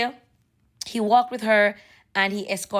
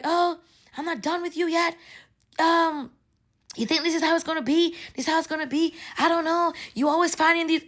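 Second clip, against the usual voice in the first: imitated Bahamian accent, pitched higher; 5 semitones higher than usual. Angry lady confronts the man outside, imitated Bahamian accent. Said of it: "the Bahamian, they have an accent".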